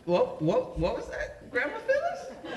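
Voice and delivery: stammering, incredulous, rising to a squeal; shocked disbelief. Retold or imitated. Grandson stunned by grandma's joint request, squealing. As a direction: pitch climbing to a high squeak on "Phyllis".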